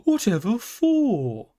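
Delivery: high, slow, bewildered question; astonished calf. A narrator's reading. Calf asking the pony why he'd leave, bewildered. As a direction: still reeling, ask why.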